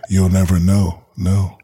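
mock-sexy line with spoken echo; homeboy echo. Shown as a demonstration. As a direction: repeat the last word like your own echo. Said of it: "The, the homeboy echo".

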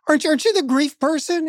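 put-on high, fast, eager young woman; imitating a young neighbour rushing up; young woman's question. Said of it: "And she goes".

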